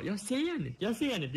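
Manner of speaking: high-pitched mock complaint; imitating a teammate. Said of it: "Och Hugo bara".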